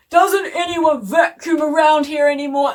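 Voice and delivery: mock an exasperated dad's outburst; angry dad. Retelling Dad stomping on thistles, angry mock shout. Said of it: "he got so angry and he said".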